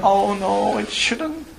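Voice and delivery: high, whimpering mock lament; mock crying. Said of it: "I'm crying and saying".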